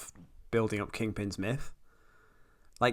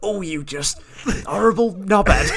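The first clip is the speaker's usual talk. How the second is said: exaggerated British accent, rising to a louder, higher jab; teasing a friend by parroting his British rant; mock British insult; 6 semitones higher, 5 dB louder than usual. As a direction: ham up a British telling-off, punching knobhead. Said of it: "you've ever sounded more British".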